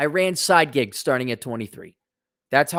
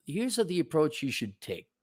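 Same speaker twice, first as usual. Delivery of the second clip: ordinary talk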